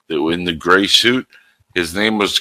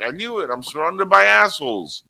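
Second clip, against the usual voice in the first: high mock exasperated quote; 10 semitones higher than usual. Quoting Dark Helmet again.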